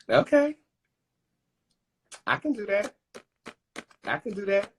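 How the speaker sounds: excited. Real, not acted. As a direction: squeal with delight at the card. Excited at card pulled.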